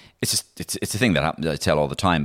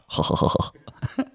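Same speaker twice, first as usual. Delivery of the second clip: little mock giggle in character; self-deprecating giggle; 4 semitones lower than usual. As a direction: imitate a bashful footballer's giggle. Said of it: "It was a self-deprecating kind of giggle".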